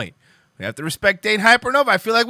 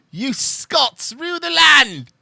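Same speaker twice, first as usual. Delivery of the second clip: slow, higher mock-Scottish proclamation; grand, poorly attempted Scottish flourish; pretend Scottish accent; 5 semitones higher, slower than usual. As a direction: stretch it out in a badly faked Scottish accent. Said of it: "pretend I'm doing one".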